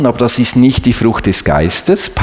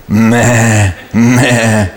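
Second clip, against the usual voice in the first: low bleating meh, laughing; sheep bleat; 3 semitones lower than usual. Retold or imitated. Sheep bleating back at David.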